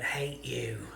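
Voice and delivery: creepy voice. Retold or imitated. Ghost hunter repeats what the spirit voice said, lightly creepy. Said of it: "that was a creepy voice".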